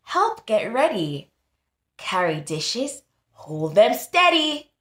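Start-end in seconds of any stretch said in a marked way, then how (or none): none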